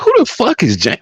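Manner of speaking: high, disbelieving yelp; incredulous outburst. Retold or imitated. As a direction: squeak out the name in total disbelief. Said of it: "I'm like".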